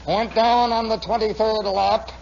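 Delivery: slow, drawn-out loudspeaker announcer; race announcer. Retold or imitated. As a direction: stretch it out like a track announcer. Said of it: "and then they'd say".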